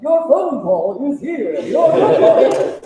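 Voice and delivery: slow, sing-song phone alert voice; ringtone voice. Retold or imitated. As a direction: imitate a drawn-out phone ringtone. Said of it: "his phone goes off and it's the".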